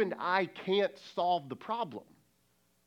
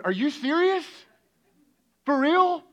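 high, loud incredulous questions; acted-out exasperated disbelief; God in disbelief; 8 semitones higher, 8 dB louder than usual. God answering a half-hearted prayer, incredulous. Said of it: "but God has to be going".

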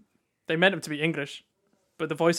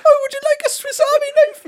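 very high, loud squeal of fake Swiss; mocking pitched-up Swiss; 11 dB louder than usual. Friend mocking the Swiss attempt, loud and squeaky. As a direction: parody a bad Swiss accent. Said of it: "pitched up and then just talking".

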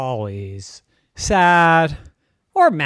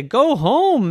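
exasperated friend; 9 semitones higher, 6 dB louder than usual. A narrator's reading. Friend tells Kathy to go.

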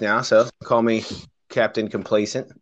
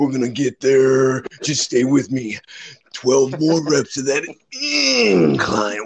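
Macho Man Randy Savage; 3 semitones higher than usual. Pretend. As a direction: growl it like a hyped wrestler. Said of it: "I want this to be a wrestling promo".

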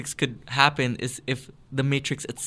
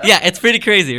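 laughing; 7 semitones higher, 11 dB louder than usual. Real, not acted. Laughing while explaining the twist.

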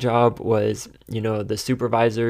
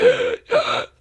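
sharp, high gasp for breath; imitated gasp for air; 4 dB louder than usual. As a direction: gasp like you've just run to work. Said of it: "I show up and I am so out of breath".

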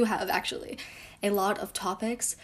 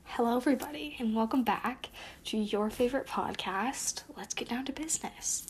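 podcast intro; 2 semitones higher, 5 dB quieter than usual. Acted.

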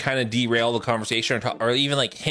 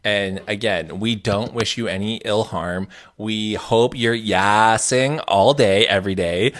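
measured proclamation building much louder; mock official statement; 3 semitones lower, 7 dB louder than usual. Podcast host addressing the exes, mock-official proclamation. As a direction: declare it for the record, getting louder.

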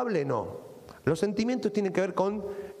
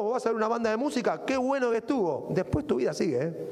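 ordinary talk, nothing marked

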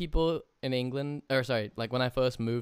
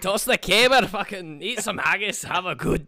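higher, laughing hammed-up Scottish accent; mock Scottish accent; 8 semitones higher, 7 dB louder than usual. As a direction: lay the Scottish accent on thick, teasing and laughing. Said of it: "you already know some accents".

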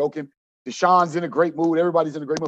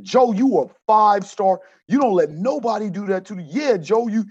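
higher, louder hype-up; pumping him up; 7 semitones higher, 4 dB louder than usual. Retold or imitated. Coach backing Joe after an altercation, pumping him up. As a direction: fire up a teammate emphatically. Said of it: "I just pumped him up".

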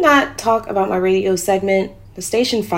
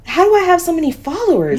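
much higher and louder, astonished; can't believe her eyes; amazed disbelief; 11 semitones higher, 4 dB louder, faster than usual. Acted. Woman discovering her follower count, amazed outcry.

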